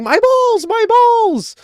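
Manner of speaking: very high, loud imitation of screaming in pain; mock agonized scream. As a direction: shriek it like a man in agony, hammed-up. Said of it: "He started screaming in agony. He's screaming".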